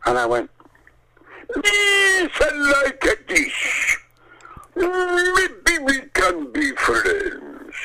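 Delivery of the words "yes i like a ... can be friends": much higher, very slow, strange gurgling creature voice; Boss Nass voice. Acted. Brian auditioning as Boss Nass for George, slow high creature voice. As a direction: stretch each word out like a big wobbly alien king. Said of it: "I did this extraordinary thing with my mouth".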